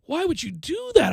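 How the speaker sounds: high, pleading reenacted disbelief; mock dismay. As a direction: question the author as a duped reader. Reenacting dismay while reading the book.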